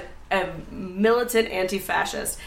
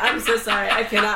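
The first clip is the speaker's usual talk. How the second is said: usual speaking voice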